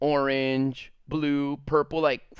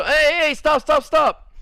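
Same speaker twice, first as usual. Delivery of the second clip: higher, louder "hey, stop" from a teacher; teacher shouting stop; 8 semitones higher, 4 dB louder than usual. Retold or imitated. Teacher stopping the boy charging at him, higher louder shout. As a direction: halt a running kid with a sharp call.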